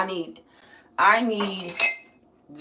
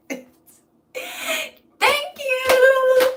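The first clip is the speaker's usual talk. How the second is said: high loud shriek; squealed thanks; 8 dB louder than usual. Real, not acted. Thanking a viewer, squealed high and loud. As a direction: squeal the word.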